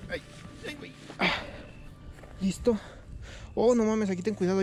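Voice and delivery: usual speaking voice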